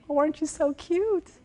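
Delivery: high mock "Oh, aren't you so cute?"; condescending man. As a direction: patronize her like a cute kid. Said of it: "they think".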